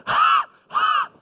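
short winded laugh, caught out; breathless laugh. Real, not acted. Preacher laughing at himself to the congregation, breathless laugh. Wife smiling at the top.

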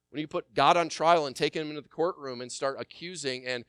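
normal talking voice

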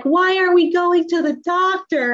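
high, lightly voiced small child asking; little daughter's voice. Mom imitates her daughter in the car, high and childlike. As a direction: pitch it up like a puzzled five-year-old.

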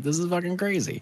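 high, incredulous exclamation; a sudden high-pitched "can you believe this"; disbelief. Retold or imitated. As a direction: squeak it out in shocked disbelief. Retelling own disbelief, I was like.

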